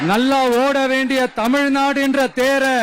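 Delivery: loud campaign oratory over a crowd; rally speech. Real, not acted. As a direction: rouse the Tiruvarur crowd, mocking the CM. Loud rally oration in Tiruvarur. (உரத்த பிரச்சார மேடைப் பேச்சு)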